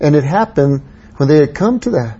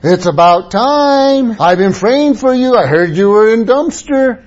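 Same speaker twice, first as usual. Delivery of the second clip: mock scolding; 8 semitones higher, 3 dB louder than usual. No need to ask them.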